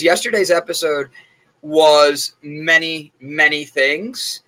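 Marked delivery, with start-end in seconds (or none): 1.6-4.4 slow, drawn-out pause over "many, many". Real, not acted. Hinting the episode was wild, drawn out slowly. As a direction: stretch "many, many things" very slowly and meaningfully. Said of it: "Informative is the word I'm gonna use".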